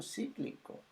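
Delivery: a hushed echo of cíclico; whispered aside. Acted. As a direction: whisper "Cíclico" high and breathless. Aside repeating the word.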